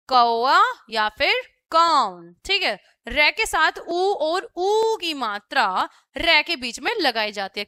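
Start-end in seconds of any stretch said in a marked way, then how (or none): 0.1-2.3 कौआ and कौन said very slowly and a bit louder; slow example words. Shown as a demonstration. Teacher answering her own question slowly. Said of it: "शब्द क्या बन जाएगा".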